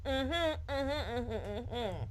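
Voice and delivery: hums through a mask; muffled mumbling; muffled under a mask. Pretend. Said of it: "voice is all muffled under the mask".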